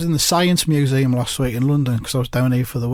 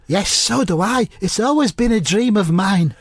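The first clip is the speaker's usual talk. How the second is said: higher, drawn-out impression; Mark Lawrenson; 5 semitones higher than usual. Mark agrees to open a shop, in high impression. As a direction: stretch it out in a raised comic voice. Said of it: "I'll just say them as Mark".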